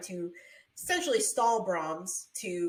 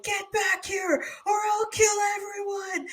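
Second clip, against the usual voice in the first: screamed threat; 13 semitones higher than usual. Retold or imitated. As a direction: imitate a psychotic killer screaming at someone. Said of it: "childlike voice to screaming".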